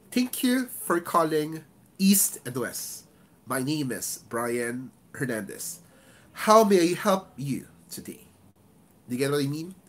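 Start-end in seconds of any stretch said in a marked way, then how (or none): 0.1-8.3 slow greeting, EAST drawn out, HOW MAY I HELP lifted; slow down on "East and West" and the name, punch "How may I help"; deliberate agent greeting, stress on key words. Shown as a demonstration. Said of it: "Where did I give stress?".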